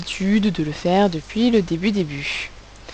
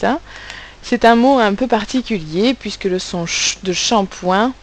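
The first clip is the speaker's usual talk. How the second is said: ordinary talk, nothing marked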